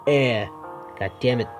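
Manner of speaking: ordinary delivery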